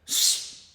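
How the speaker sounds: a quick voiceless shush; guard's shush. Retold or imitated. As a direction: hush the prisoner from behind the shield. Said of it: "I hear him go".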